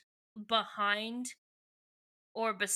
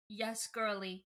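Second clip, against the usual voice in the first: mock-solemn; 3 semitones lower, 7 dB quieter than usual. Mock-solemn breaking of bad news.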